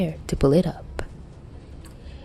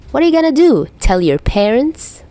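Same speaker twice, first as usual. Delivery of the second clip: mocking jab; 7 semitones higher, 5 dB louder than usual. A narrator's reading. Dazai's dark joke at Atsushi.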